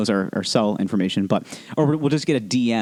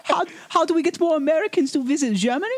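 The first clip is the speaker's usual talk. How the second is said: much higher, laughing German tourism pitch; Sven's voice. Pretend. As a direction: squeak it out like a giddy German official. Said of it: "Sven just walked in".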